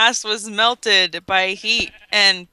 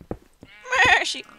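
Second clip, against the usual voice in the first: squeaky echo; 13 semitones higher than usual. Pretend. Echoing with a high squeak.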